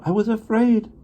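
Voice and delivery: admitting fear. A narrator's reading.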